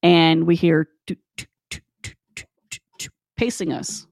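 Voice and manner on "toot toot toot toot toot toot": soft clicked footstep sounds; imitated footsteps. Storyteller imitates footsteps pacing behind them in the dark, soft clicks. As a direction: tap out quiet, steady steps with the mouth.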